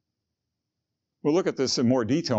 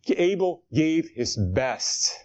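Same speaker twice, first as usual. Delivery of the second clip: slow emphasis; much slower than usual. Acted. Preacher stressing Abel's offering.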